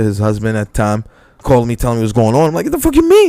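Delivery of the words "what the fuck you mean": shocked disbelief. Retold or imitated. Retold reaction to fight call.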